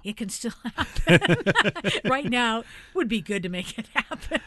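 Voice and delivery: amused plea broken up by laughs; laughing. Real, not acted. Host laughs while pleading for donations.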